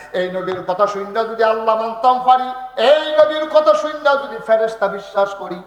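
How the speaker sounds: building to a high, loud cry; impassioned preaching. Acted. Abu Bakr declares belief on the Prophet's word, building loud. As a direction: raise the voice with each clause.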